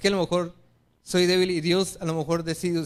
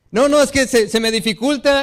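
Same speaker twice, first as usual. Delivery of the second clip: louder, higher, pleading excuse; insistent excuse; 5 semitones higher, 5 dB louder than usual. Retold or imitated. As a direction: raise it up, a touch more insistent. Third, most insistent excuse.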